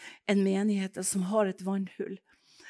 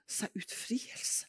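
startled whisper; 9 dB quieter, slower than usual. Retold or imitated. Startled at friend's request.

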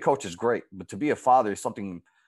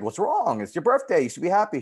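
higher, retold coaxing to a tearful girl; cheering her up; 8 semitones higher than usual. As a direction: lift her spirits, voice pitched up. Comforting a girl in tears.

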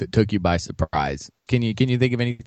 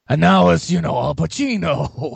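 loud late-career actor impression; Al Pacino; 4 semitones higher, 4 dB louder, slower than usual. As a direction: burst out loud, then stretch the name out. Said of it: "same thing that happened to Pacino".

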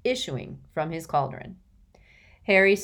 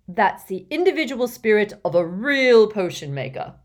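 loud, hearty and pleased; happy; 4 semitones higher, 8 dB louder than usual. A narrator's reading. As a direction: beam with pride. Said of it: "said Slughorn happily".

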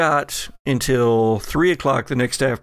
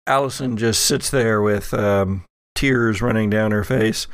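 usual speaking voice

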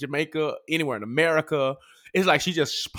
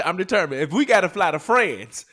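excited retelling; 6 semitones higher, 5 dB louder, slower than usual. Retold or imitated. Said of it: "I told Asher".

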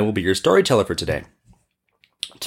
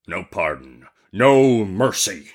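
slow, merciless genie voice; exclaiming genie; 3 semitones lower, slower than usual. Acted. Genie refuses the merchant's plea, slow menacing exclamation. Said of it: "exclaimed the genie".

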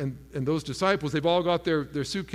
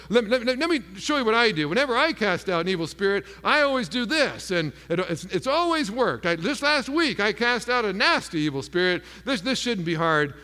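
higher-pitched, eager know-it-all disciple; lightly acted overconfidence, pitched up and insistent; cocky boasting; 6 semitones higher, 3 dB louder than usual. Andrew to doubting Thomas, showing how it's done, cocky.

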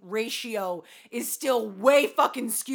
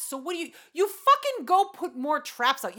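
ordinary talk

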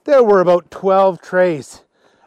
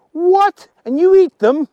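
loud, high incredulous question; acted appalled surprise; shocked disbelief; 13 semitones higher, 6 dB louder than usual. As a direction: exclaim in high-pitched horror. Archie shocked that they eat them.

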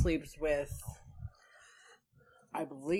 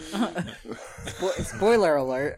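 laughing; much slower than usual. Real, not acted. Laughing at joke.

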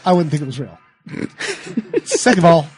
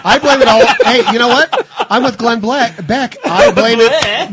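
ranting pundit; 6 semitones higher, 7 dB louder than usual. Pretend. Mocking a pundit's rant.

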